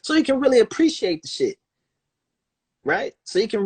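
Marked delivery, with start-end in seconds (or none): 0.0-1.6 pushy voice that goes much higher at the end, as if talking down; mocking rich friend. Friend pushing him to play somewhere expensive, mock pushy voice. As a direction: urge him to play the pricey place, in a put-on voice. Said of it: "They like".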